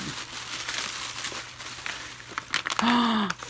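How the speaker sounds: long breathy delighted gasp; real gasp of delight; excited gasp. Real, not acted. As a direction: gasp at the hot cocoa. Opening gift package.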